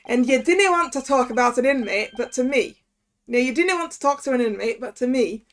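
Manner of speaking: Scottish prison governor; Scottish accent. Acted. Governor questions visitors about an inmate, Scottish accent. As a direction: put on a Scots governor's voice. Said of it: "okay, we gotta do Scottish".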